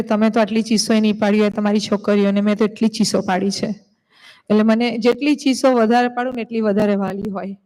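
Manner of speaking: rushed, tumbling words; rapid talk. Real, not acted. Teacher tells parents how much she scolded them, fast. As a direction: pour it out fast.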